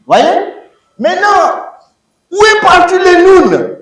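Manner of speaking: high, loud mock surprise; mock astonishment. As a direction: ask where it went as if shocked. Dramatic question to class. (étonnement feint, aigu et fort)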